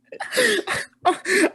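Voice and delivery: high-pitched cracking-up laugh; helpless laughter. Real, not acted. Cracking up at the co-host's question, helpless laughter. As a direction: laugh until the voice goes high.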